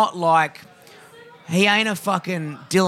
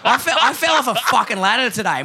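words spilling out through laughter; laughing while talking; 6 semitones higher than usual. Real, not acted. Laughs while telling fall.

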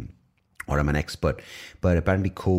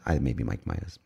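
ordinary talk, nothing marked

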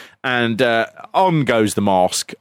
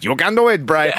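higher, lightly voiced pig puppet; a hint of a stock puppet voice; Huskus; 3 semitones higher than usual. Host repeats the pig puppet's line, mock puppet voice. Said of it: "when Huskus goes".

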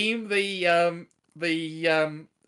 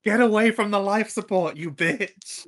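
louder, higher action-hero command; Ellen Ripley; 3 semitones higher, 4 dB louder than usual. Acted. Player announcing a forklift attack, mock action-heroine voice. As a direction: play the film heroine facing the alien. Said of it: "Ellen Ripley in Aliens".

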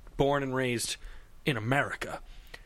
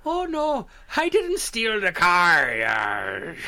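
very slow, drawn-out pirate voice, louder; mock pirate; 3 semitones higher, 4 dB louder, much slower than usual. S0 mimics S1's accent slipping into pirate. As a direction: ham up a pirate denial, stretching every word. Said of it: "you would go into pirate".

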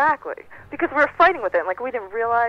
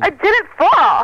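high, loud, slow denial; outraged, loud and drawn out; indignant protest; 9 semitones higher, 8 dB louder, much slower than usual. Real, not acted.

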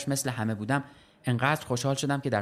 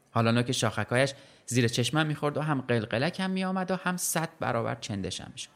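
plain reading voice

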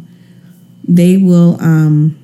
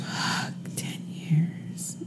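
breathy whisper of disbelief; 2 semitones lower, 20 dB quieter, much slower than usual. Real, not acted. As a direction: whisper it in awe.

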